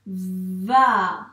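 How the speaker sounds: VA with long v and held a; syllable demo. Shown as a demonstration. Teacher sounding out a word for a pupil, drawn-out VA. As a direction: stretch VA as its own syllable. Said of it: "syllabe par syllabe".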